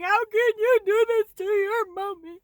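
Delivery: high, loud, wailing plea; hammed-up tearful whine; mock crying. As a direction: wail it like a betrayed pet owner in pain. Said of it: "I was crying".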